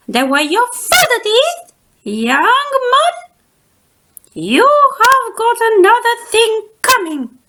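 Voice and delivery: put-on high, loud scolding old-lady voice; high, loud teacher warning the cub; stern old teacher. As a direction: wag a finger at the boy. Teacher warns cub, voiced character.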